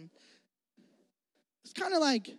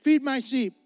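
higher, louder rising sermon climax; preaching at full force; 6 semitones higher, 4 dB louder than usual. Acted. Pastor urging the congregation out of guilt, preaching hard. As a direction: preach it, building conviction.

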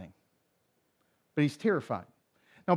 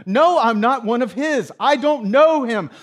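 loud, high-pitched insistent protest; forceful denial; 6 semitones higher, 9 dB louder than usual. Retold or imitated. Peter denying he is one of Jesus' followers, forceful denial. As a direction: push back hard, voice raised.

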